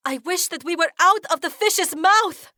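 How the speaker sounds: very high, loud desperate wish; shout. A narrator's reading. Heroine shouting her wish to escape the fish. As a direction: cry the wish out. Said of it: "I shout".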